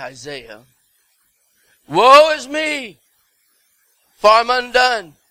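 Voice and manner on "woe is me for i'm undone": high, loud, drawn-out lament; Isaiah's woeful cry. Acted. Preacher reading Isaiah's confession, loud high cry. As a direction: cry out in woe, stretching the words.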